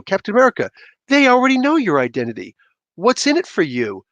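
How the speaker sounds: a hint of a puzzled genius; lightly voiced Reed Richards. Reed addressing Captain America.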